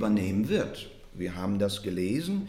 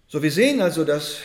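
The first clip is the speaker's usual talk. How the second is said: loud resumption; 5 semitones higher, 10 dB louder, faster than usual. Real, not acted. Preacher raises voice to resume.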